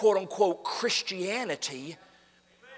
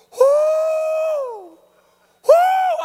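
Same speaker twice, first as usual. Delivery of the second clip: very high, loud ecstatic whoop; joyful whoop; 15 dB louder than usual. Retold or imitated. Old man just saved at the altar, ecstatic loud whoop. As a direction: cry out with relief and joy.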